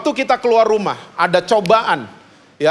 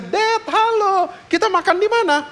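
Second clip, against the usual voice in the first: put-on cheerful girl voice; 14 semitones higher than usual. Daughter getting into the car, greeting her dad cheerfully and high. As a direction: greet dad happily with a laugh.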